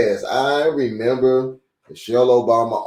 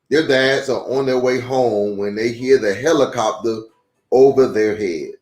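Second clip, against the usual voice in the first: steady, clear read-aloud voice; reading to children; 5 semitones higher than usual. A narrator's reading. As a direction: read it like a picture book for kids. Reads a children's book aloud.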